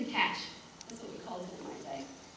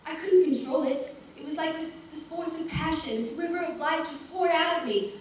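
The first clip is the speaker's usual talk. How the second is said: higher, breathless-with-memory rush of feeling; swept up in passion; 6 semitones higher than usual. Acted. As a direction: relive an overwhelming first passion, pitch lifted. Character relives overwhelming passion.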